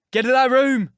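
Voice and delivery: bellow, nearly inarticulate with passion; furious shout. A narrator's reading. Earnshaw throwing Linton out, furious roar. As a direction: roar it, choked with rage. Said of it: "in a voice almost inarticulate with passion".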